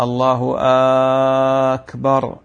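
الله أكبر with the hamza of أكبر stretched long; lengthened first vowel of أكبر; muezzin's error, drawn-out أكبر; mispronounced takbir. Shown as a demonstration. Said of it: "هذا لحن جلي".

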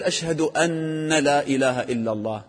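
"أن لا" said as a long "أنّ لا"; chanted "أشهد أنَّ لا" with the n sounded; mistaken added vowel. Shown as a demonstration. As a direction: hold a doubled n in "أن", then "nala". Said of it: "لا تقول".

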